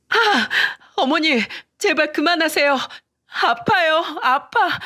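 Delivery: high-pitched, pleading yelps of pain; acted wife begging to be let go; pained cry. A narrator's reading. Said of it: "고통스러운 목소리로 소리쳤어요". (고통스럽게 높은 소리로 애원)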